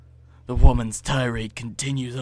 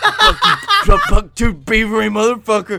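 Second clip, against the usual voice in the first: laughing rant; 8 semitones higher, 12 dB louder than usual. Real, not acted. Stumbles into laughter while ranting.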